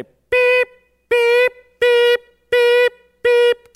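high, loud, piercing beep beep like an alarm clock. Retold or imitated. Preacher imitating God as an alarm clock, high loud beeps. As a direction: mimic an alarm going off, sharp and high. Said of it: "He starts to say".

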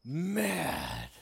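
MAD dropped low and soft; emphatic MAD. Shown as a demonstration. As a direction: lean darkly on MAD, low and quiet. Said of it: "I'm not talking about angry".

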